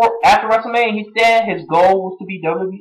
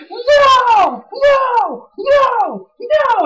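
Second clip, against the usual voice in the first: Daniel Bryan's no; much slower than usual. Retold or imitated. Fan mimicking Daniel Bryan's protest, shouted high. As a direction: mimic a wrestler's whiny protest. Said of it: "Daniel Bryan was all like".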